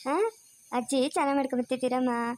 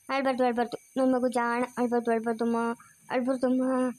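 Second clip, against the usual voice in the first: soothing; 4 semitones lower than usual. Real, not acted. Soothing a crying baby. (ಅಳುವ ಮಗುವನ್ನು ಮೆಲ್ಲಗೆ ಸಮಾಧಾನಿಸುವ ದನಿ)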